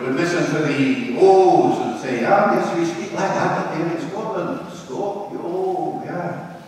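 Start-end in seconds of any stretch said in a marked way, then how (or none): 2.3-6.6 put-on lilting Scottish accent, a bit higher. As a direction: imitate an approving Scot. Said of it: "People from Scotland would".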